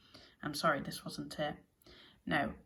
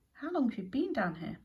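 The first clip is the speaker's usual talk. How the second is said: higher-pitched, wondering question; amazed question; 7 semitones higher than usual. A narrator's reading. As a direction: ask it in raised-pitch disbelief. Ares questions the captive ewe.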